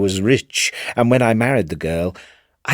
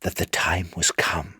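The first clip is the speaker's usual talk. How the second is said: breathy secret whisper, no voice; 4 semitones lower, 7 dB quieter than usual. A narrator's reading. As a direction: breathe it like spirits hissing in his ear. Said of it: "whispered in my ear".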